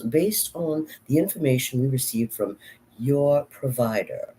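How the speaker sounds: slow, measured British accent. Shown as a demonstration. Actor reading a letter to show off accents, British accent. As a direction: read it out formally in a British accent. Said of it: "Here's my British accent".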